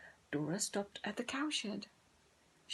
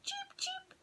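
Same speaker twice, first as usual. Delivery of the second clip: very high, soft cheep; squeaking chicks; 8 dB quieter than usual. Acted. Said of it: "the chicks squeaked".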